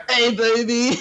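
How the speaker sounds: lightly put-on manly voice for a flirty greeting; manly-voiced woman. Movie fan imitates Aurora's greeting, manly voice. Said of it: "she's got the manly voice".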